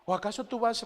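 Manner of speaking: ordinary delivery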